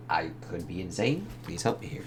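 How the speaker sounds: soft, breathy near-whisper; hushed plea. Acted. Norman quietly begging Jonah for help, near-whisper. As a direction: plead under your breath.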